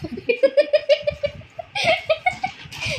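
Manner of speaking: sudden high giggling laugh; laughing at mom's protest; high burst of laughter. Real, not acted. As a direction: laugh out loud, high and bright. Laughs at mother's denial.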